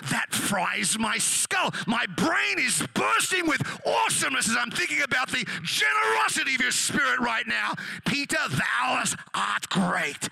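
mock-divine rapture at full volume; Jesus. Imagined Lord praising Peter's generosity, hammed-up awestruck shout. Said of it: "That's what he was expecting".

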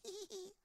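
high, soft "hee hee hee"; squeaky little mock giggle; mimicked giggle. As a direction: imitate a girlish titter.

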